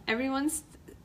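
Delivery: ordinary delivery, nothing marked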